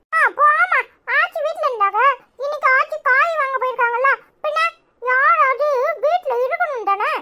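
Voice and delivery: put-on high childish protest. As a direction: kid talking back to mom. Child defends himself to mother.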